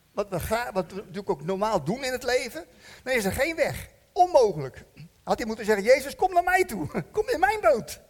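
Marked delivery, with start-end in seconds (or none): 5.8-8.0 high, loud plea with a laugh; laughing mock plea. A man in the boat asking Jesus to come to him, laughing. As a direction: beg him playfully, half laughing.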